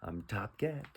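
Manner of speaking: soft, pleased-with-himself aside; proud cat. Acted. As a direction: murmur it as the self-satisfied cat. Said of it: "pointing to himself like".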